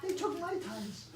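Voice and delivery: high, soft mouse complaint; a small high indignant mouse; whiny mouse. Acted. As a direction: whine like a robbed mouse.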